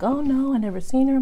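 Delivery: high, breezy fake innocence; mock innocent denial. Telling the owner she never saw the dog, a high mock-innocent denial. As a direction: play dumb lightly. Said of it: "I'm like".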